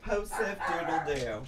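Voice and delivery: usual speaking voice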